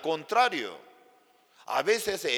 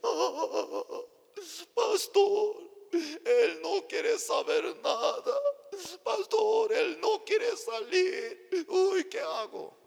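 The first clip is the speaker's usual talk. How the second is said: high, drawn-out wailing of a tearful wife; mock crying; much slower than usual. Distraught wife crying to the pastor, high mock wail. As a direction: wail it slowly with exaggerated sobs. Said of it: "estaba llorando".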